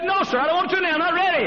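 high, emotional refusal; high-pitched no, sir; man refusing. Acted. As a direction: voice the reluctant husband high.

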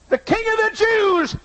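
high, sneering title; mocking. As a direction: announce the title in scorn. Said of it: "I mocked his kingship".

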